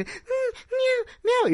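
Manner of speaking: very high, soft little meows; high mewing kitten. Acted. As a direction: meow like a tiny she-cat. Kitten voiced meowing.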